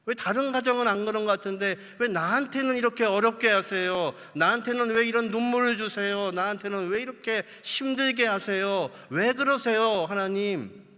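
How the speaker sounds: loud, pleading, aggrieved cry to God; complaining prayer. Acted. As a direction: voice a believer moaning why me. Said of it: "어떻게 불평이 나오냐면요". (하나님께 왜 나만이냐고 하소연하는 불평)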